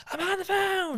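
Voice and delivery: high-pitched loud mock scream; screaming sister. Sister screaming at parents listening in on her call, mock scream. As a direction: shriek it like an irritated sibling on a call. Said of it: "I'd hear her screaming".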